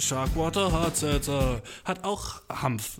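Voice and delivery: slow, drawn-out commercial voice over music; mock advertising read; ad slogan voice. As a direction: announce the brand like a TV spot. Slogan voice again.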